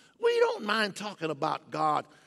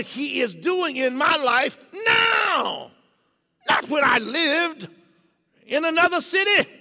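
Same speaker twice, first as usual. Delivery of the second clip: full-force preaching; 8 semitones higher, 9 dB louder than usual. Acted. Preacher building the congregation to a loud climax. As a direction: preach it out to the rafters.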